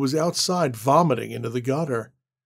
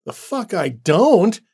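slow, louder, higher defiant retort; angry defiance; 5 semitones higher, 6 dB louder, slower than usual. Acted. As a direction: throw it back in his face and push past. Annie defies Randall.

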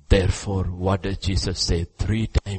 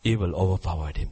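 ordinary talk

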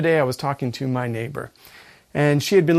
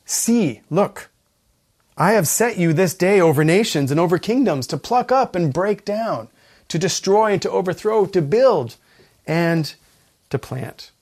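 loud, raised scripture reading; 朗々とした聖書朗読; 3 semitones higher, 4 dB louder than usual. Acted. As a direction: proclaim God's commission forcefully, easing off at the end. Reads scripture aloud with force.

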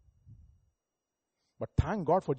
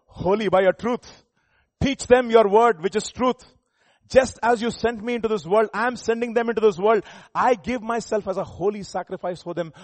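loud, raised preaching voice; forceful scripture reading; 6 semitones higher, 8 dB louder than usual. Acted. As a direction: proclaim the verse with full force. Reads scripture aloud, preaching.

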